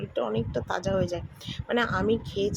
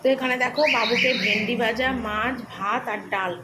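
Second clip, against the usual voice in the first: louder, proud showing of the plate; louder presenting; 2 semitones higher, 6 dB louder than usual. Real, not acted. Mother loudly presents son's lunch to viewers, proud. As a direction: announce the son's meal loudly.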